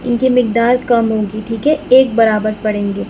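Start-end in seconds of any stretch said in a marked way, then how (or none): none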